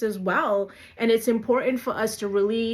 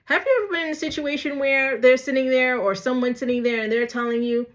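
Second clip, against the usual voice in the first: ordinary delivery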